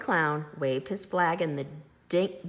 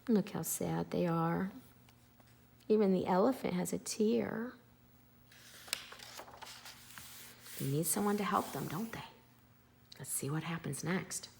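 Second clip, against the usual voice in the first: quiet, gentle storyteller aside; soft aside to kids; 6 dB quieter than usual. Acted. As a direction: lean in and ask the children. Aside to child listeners.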